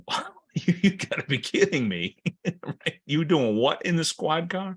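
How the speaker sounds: laughing mock disbelief; high-pitched, can't believe what you're hearing; incredulous. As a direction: react in amused astonishment. Acted disbelief at police misconduct.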